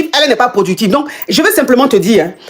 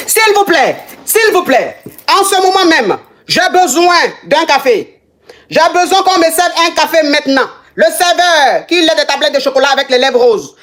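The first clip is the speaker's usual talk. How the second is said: high-pitched mock-imperious order; theatrical demand; 5 semitones higher than usual. Live host ordering coffee from the waiter, theatrically demanding. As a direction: play at grandly summoning service.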